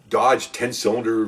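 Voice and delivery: normal talking voice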